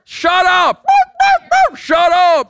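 high, loud mock yell; yelling at dog. Owner yelling at a barking dog, acted shout. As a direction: holler it like a bark. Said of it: "yelling at your dog".

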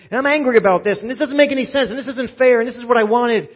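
loud, high, indignant complaints; angry venting to God. Acted. Believer unloading complaints on God, angry and loud. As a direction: pour out your anger at God. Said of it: "Let me tell you what I'm feeling".

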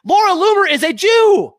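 high, loud indignant outburst; outraged yell at the network; indignant shout. Real, not acted. Angry rebuttal to CNN.

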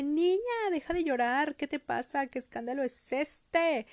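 high-pitched mock scolding; scolding Rottenmeier. Rottenmeier scolds crying Heidi, high and indignant. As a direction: play a strict woman telling a child off. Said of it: "llega la señorita Rottenmeier y le dice".

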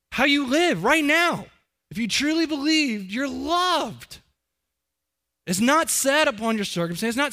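fervent preaching. Real, not acted. Preacher builds to climax.

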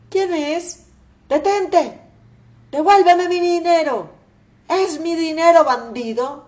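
loud, high shouting at the thief; furious shout. Acted. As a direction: yell at the thief to give it back. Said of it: "llega gritando". (gritos furiosos, mucho más fuertes)

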